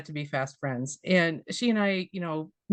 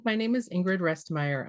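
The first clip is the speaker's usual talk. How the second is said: ordinary delivery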